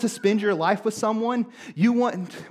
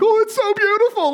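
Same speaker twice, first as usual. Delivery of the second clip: high, loud swooning over a wedding; mock gushing; 13 semitones higher, 6 dB louder than usual. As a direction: gush in a much higher voice, hamming it up. Mimics himself gushing at weddings.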